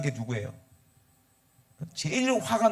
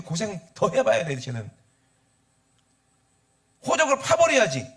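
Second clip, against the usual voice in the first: high, loud angry protest; resentful elder son; 9 semitones higher, 5 dB louder than usual. Acted. Elder son complains to father about his brother, furious. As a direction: rant indignantly at your father, pitch pushed up. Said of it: "누가 기분 나빠".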